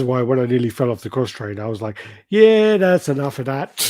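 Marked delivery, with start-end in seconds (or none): 2.3-3.8 louder, slower and higher, half-laughing "that's enough"; mock exasperated. Nearly falling off the cross trainer from laughing, so a hammed-up, slower, louder "that's enough". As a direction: call it quits in hammed-up disgust.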